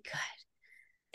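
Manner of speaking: breathy relieved whisper of good; voiceless relieved good. Retold or imitated.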